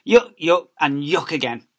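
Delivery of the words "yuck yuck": ordinary delivery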